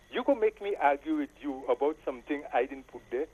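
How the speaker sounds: ordinary talk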